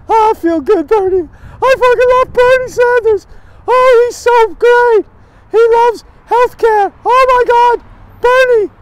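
high, loud, swooning fan voice; mocking gushing fan. Mocking Bernie fans to the camera in a high, gushing swoon. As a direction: gush over Bernie in a hammed-up high voice. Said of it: "All you're talking about is the feel-good".

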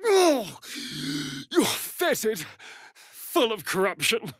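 stretched out very slowly, high with contempt; disgusted drawl. Acted. Vampire sneers at something rotten, slowly and high. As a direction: savour the insult slowly, sneering.

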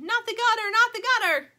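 high, very loud plea; yell. A narrator's reading. Adults yell at the bowling alley, urgent. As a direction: shout it urgently at the ball. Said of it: "The adults yell".

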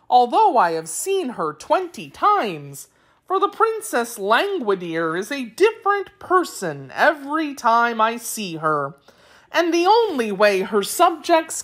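high, singsong creature voice with big swoops; explaining Wheeler. A narrator's reading. Wheeler explaining the royal family, high singsong voice. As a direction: tell it in exaggerated, swooping tones.

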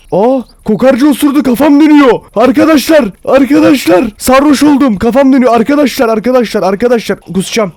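high, loud panicked wailing; high-pitched overdramatic alarm, easing at the end; mock panic. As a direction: cry out to friends in hammed-up distress. Skunk sprays him, feigns reeling.

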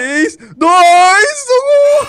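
excited countdown. Real, not acted. Final countdown to winning.

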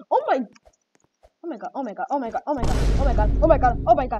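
building from plain to loud repeated oh my god; panicked gamer freakout; rising panic. Real, not acted. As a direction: spiral into a frantic chant. Panic as character jumps.

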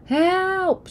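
high terrified scream for help; shrill panicked cry. A narrator's reading. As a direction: wake up screaming in terror. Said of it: "she screamed".

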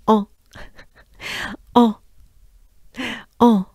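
"O" as a rounded French nasal vowel; French O sound. Shown as a demonstration. Teacher drilling the O sound for the class. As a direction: round the lips for "O". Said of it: "this sound E goes into O".

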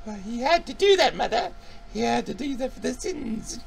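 put-on high, eerie girl's voice; a lightly voiced creepy girl talking to her mother; possessed daughter. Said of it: "she said".

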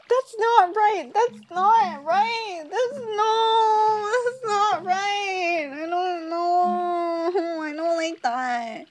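a mock-distressed whine; creeped out. Real, not acted. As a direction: back away uneasy. Disturbed by creature design.

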